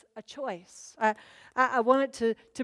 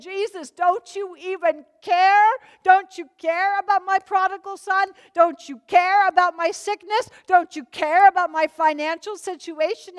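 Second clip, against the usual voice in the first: high, loud pleading cry to Jesus; higher, louder, pleading prayer of panic; desperate plea; 6 semitones higher, 7 dB louder than usual. Retold or imitated. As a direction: beg him like you're sinking.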